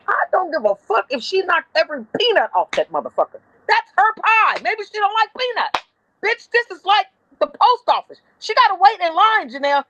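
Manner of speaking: high-pitched indignant rant; pissed-off outburst. Retold or imitated. Supervisor defending a pie-throwing customer to her boss, retold angry rant. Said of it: "I got so pissed off".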